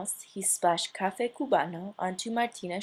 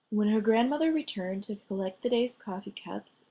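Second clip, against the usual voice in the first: ordinary delivery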